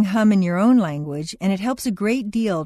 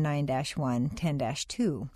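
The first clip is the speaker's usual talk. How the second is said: ordinary talk, nothing marked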